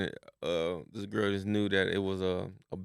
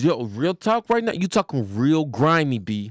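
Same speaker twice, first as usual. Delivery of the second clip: loud, higher-pitched mock New York slang; New York accent; 5 semitones higher, 6 dB louder than usual. Mocking New Yorkers' catchphrases to a friend, exaggerated New York accent. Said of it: "That's how they be saying".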